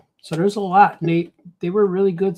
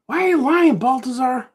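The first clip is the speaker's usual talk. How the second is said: very high mock heckler voice; high-pitched mock accusation; accusing heckler; 9 semitones higher, 3 dB louder than usual. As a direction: call him out in a squeaky put-on voice. Imagined heckler accuses him of lying.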